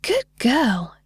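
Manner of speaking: high, swooping praise; sing-song fairy. A narrator's reading. Delighted fairy praises the girl, sing-song voice. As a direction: praise the child in a fairy lilt. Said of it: "in her sing-song voice".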